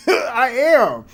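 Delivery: laughing admission. Real, not acted. Laughing at own threat.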